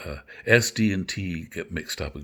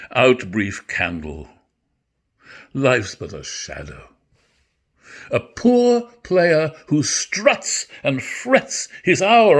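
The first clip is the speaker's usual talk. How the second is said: dramatic Shakespeare recitation; 3 semitones higher, 6 dB louder, slower than usual. Acted. Actor performing Macbeth's soliloquy for listeners, full stage voice. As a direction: play the despair of the speech in full.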